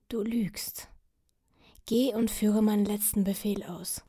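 weak whispered rebuke; dying whisper. Acted. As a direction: whisper firmly through the weakness. Dying king rebukes knight.

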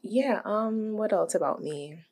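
usual speaking voice

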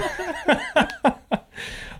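high-pitched burst of laughter. Real, not acted. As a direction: cackle with delight. Laughing at the joke.

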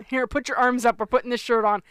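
loud, hurried-parent order; impatient parent. Retold or imitated. Parent needing to get to work, loud impatient orders.

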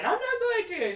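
high-pitched mock refusal; defiant retort. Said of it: "so Candace is like".

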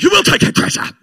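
high, loud rasping order; wife getting loud. Retold or imitated. Preacher imitating his wife giving orders at home, getting loud. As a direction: imitate a wife laying down the law. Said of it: "She'd get a little loud".

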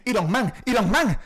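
"Iron Man" read literally as "i-rón man", high and loud; Spanish-dub pronunciation. Retold or imitated. Friend mocking the Avengers dub, "Iron Man" loudly said as "irón man". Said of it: "y los Vengadores".

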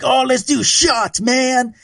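high, slow party-bro call; Kevin voice. Retold or imitated. Kevin at the bar pushing shots, high and slow. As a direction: drag it out way up high like a drunk buddy. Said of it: "he was just like".

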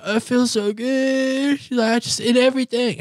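very high, stretched-out whimper; mock weepy relief in a squeaky voice; imitated tearful patient. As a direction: whine it out as if overcome. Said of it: "And then she starts crying".

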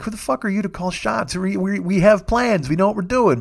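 gritted teeth. Retold or imitated. Silently fuming at her calling shots, gritted teeth. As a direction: protest through gritted teeth, holding it back. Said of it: "I'm just gritting my teeth".

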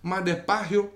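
lightly voiced Puerto Rican star in Spanish with a Cuban lilt; mock Latin pop star chatter in Cuban-tinged Spanish. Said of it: "I'm still doing the little Cuban at the end".

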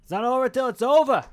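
defiant shout. Acted. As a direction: fire it back defiantly. Bobby defiant when told quit.

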